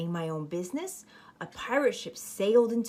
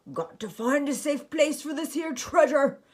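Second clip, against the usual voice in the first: louder pirate proclamation; pirate captain announcing; 6 semitones higher, 10 dB louder than usual. Acted. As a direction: announce it to the whole ship. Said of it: "he announced".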